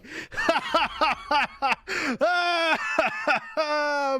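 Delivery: high, long evil villain cackle; hammed-up villain laugh. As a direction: cackle like a cartoon overlord. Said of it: "am I overdoing it".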